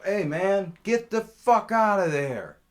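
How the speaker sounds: high, full-volume yell; angry chasing-off shout. Retold or imitated. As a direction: holler to run a trespasser off. Chasing off man caught pooping.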